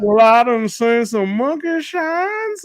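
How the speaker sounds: put-on slow, drawn-out old-time servile voice; tap dance man impression. Streamer acting the Them character asking Mister Henry, drawn out slowly. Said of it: "That was a good accent there".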